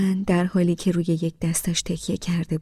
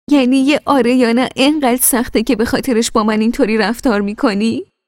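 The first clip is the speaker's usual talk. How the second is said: higher, louder, exasperated demand; spiteful outburst; 6 semitones higher, 8 dB louder than usual. A narrator's reading. Wife to silent husband in bed, angry and louder. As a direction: hurl the question at him in frustration. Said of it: "از لجم مشتم را با حرص روی بالش کوبیدم".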